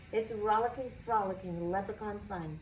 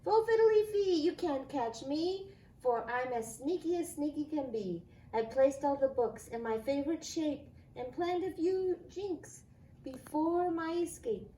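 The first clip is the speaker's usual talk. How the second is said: teasing leprechaun; 5 semitones higher than usual. Acted. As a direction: crow it like a cheeky imp. Leprechaun's note read aloud.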